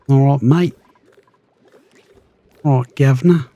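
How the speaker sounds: chirpy mock Cockney to the critter. Player greets a wandering critter, cheeky Cockney.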